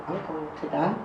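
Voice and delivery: husband voiced quietly in defeat; despairing husband. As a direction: let it sink to almost nothing.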